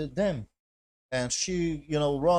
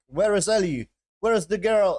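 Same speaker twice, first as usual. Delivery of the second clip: louder, higher, demanding questions; mock interrogation; 7 semitones higher, 8 dB louder than usual. Joel interrogating captives about the girl, acted demanding. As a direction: act out a forceful interrogator. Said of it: "interrogate them".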